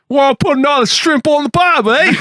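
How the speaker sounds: overdone Australian clown voice; cartoonish Australian twang; bad Australian accent. Shown as a demonstration. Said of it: "Usually it's like".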